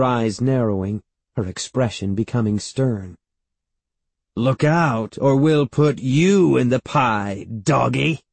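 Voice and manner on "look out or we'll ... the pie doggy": raised, drawn-out warning; exclaimed threat. A narrator's reading. Great-aunt glaring at the barking dog, exclaiming. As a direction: warn with teasing menace. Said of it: "she exclaimed".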